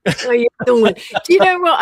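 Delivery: loud, broad New York "How you doing?"; hammed-up New York wiseguy; New York accent. Answering his co-host in a loud mock New York accent. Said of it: "switch into a really broad New York accent".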